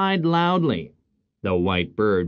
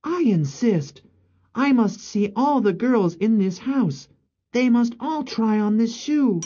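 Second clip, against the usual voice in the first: higher-pitched, firm royal command; insistent prince; 12 semitones higher than usual. Acted. Prince demanding to see all the girls, insistent. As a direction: lay down the rule as prince.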